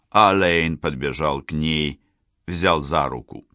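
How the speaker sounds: ordinary delivery, nothing marked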